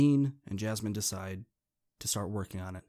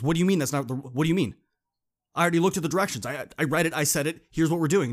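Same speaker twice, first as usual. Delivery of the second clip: louder, higher, defensive push-back; indignant protest; 6 semitones higher, 8 dB louder, faster than usual. Retold or imitated. Dean snapping back at Jasmine's objection, loud and indignant. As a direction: defend your plan hotly.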